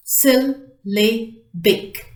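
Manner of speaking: "Syllabic" slowly as syl, la, bic; three separate beats on "Syllabic"; split into syllables. Shown as a demonstration. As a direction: sound out "Syllabic" slowly with gaps between syllables. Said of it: "three syllables".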